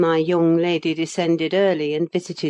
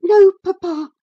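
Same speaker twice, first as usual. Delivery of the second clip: put-on high, louder gasping girl's voice; breathless young girl, much higher; gasped girl; 10 dB louder than usual. Girl answering her father, gasping and high. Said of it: "she gasped".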